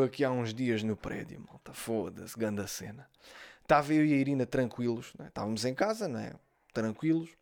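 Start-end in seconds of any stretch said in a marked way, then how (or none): none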